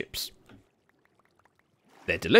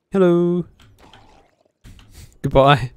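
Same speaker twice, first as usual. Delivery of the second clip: playful; 8 semitones higher, 9 dB louder, slower than usual. Real, not acted. Greeting then killing mob.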